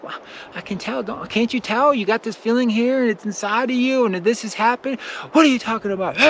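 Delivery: high, pleading disbelief; bewildered protest. Retold or imitated. Friend explaining energy to a norm, in high baffled protest. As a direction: voice jumps up, hurt and baffled. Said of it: "you're gonna think like".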